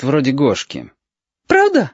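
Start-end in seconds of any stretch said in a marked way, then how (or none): 1.5-1.9 put-on very high, delighted little boy; overjoyed child asking hopefully. A narrator's reading. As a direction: squeak it as a thrilled question. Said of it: "обрадовался Гошка".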